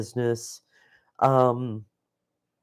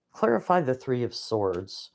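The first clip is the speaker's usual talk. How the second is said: usual speaking voice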